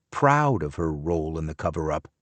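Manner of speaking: ordinary delivery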